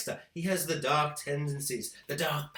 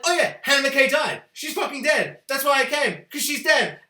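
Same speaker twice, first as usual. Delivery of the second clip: louder, higher gleeful report; excited, raised voice, happy he's dead; gleeful mock Dexter; 6 semitones higher, 5 dB louder than usual. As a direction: announce it like great news. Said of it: "he's like".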